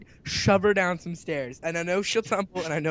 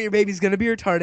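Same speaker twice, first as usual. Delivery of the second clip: ordinary talk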